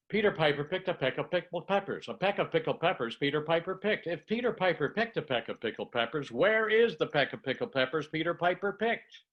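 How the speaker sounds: the whole Peter Piper twister on a single breath; in one breath. Shown as a demonstration. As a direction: rattle it off without breathing. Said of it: "one breath, take a breath".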